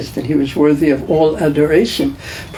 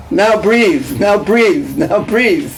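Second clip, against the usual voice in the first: a higher, lightly nagging mother repeating herself; 4 semitones higher, much slower than usual. Retold or imitated. Preacher imitating a nagging mother telling her child to breathe. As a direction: mimic a mum coaching her child to breathe. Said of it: "your mother would always be telling you to breathe".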